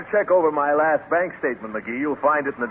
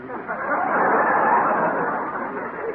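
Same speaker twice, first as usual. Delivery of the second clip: rising breathy titter; host tittering at a joke; high nervous chuckle; 8 semitones higher than usual. Acted. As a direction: laugh nervously going up. Gildersleeve's signature laugh.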